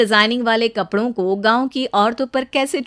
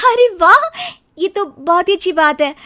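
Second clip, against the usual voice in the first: excited; 7 semitones higher, 4 dB louder than usual. Acted. Ritu thrilled by fashion week call.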